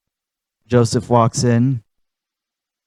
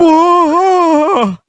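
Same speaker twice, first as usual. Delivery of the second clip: much higher, louder drawn-out shocked whoa; long scandalized cry; Hank Hill; 6 dB louder than usual. Retold or imitated. Hank reacting to Bobby's polyamory, high long shocked whoa.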